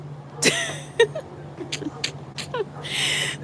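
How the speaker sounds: squealing high laugh; real high laughter; high laughing fit. Real, not acted. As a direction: burst of high-pitched laughter. Laughing at own joke.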